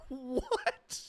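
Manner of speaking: tiny high squeaked question through laughter; laughing disbelief. Real, not acted. Laughing disbelief at tanning bed.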